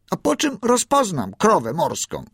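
high-pitched puzzled question; piping, curious voice; young seal asking. A narrator's reading. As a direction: ask it high, like a small seal. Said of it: "spytał".

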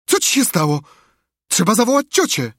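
high, loud anxious questioning; alarmed. A narrator's reading. Sid scared by his brother's groaning, alarmed. Frightened brother reacting to moans.

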